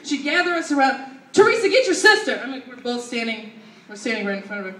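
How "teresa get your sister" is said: higher, louder bossy mom voice; mock yelled command; imitating mom yelling. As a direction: order the kid around like a theatrical mother. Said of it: "the production of yelling at us".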